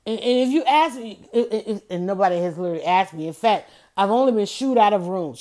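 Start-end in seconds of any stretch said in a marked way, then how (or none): none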